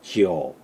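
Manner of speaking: teacher showing the correct 지오; correct pronunciation demo. Shown as a demonstration. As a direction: model 지오 slowly, gliding into o. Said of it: "이렇게 발음합니다".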